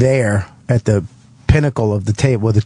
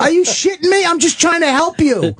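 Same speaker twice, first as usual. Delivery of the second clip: much higher, mock-indignant squeal; hammed-up disbelief, pitched way up; retold outrage. Comic retelling being bumped off the line, high outraged squeal. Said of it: "And I'm like".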